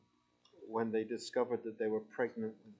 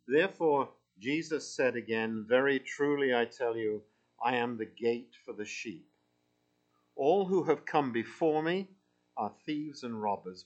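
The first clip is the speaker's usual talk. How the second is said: scripture reading; 2 semitones higher, 4 dB louder than usual. Acted. Reads scripture aloud to congregation.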